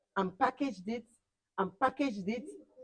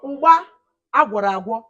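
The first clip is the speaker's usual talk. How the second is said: loud boast; 11 dB louder than usual. Shown as a demonstration. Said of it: "is nothing compared to ugba agwọrọ agwọ".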